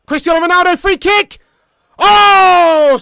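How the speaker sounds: high, loud shout at the shot; excited commentary. Real, not acted. Commentator calling Ronaldo's free kick, excited shout. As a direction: build to a roaring "oh".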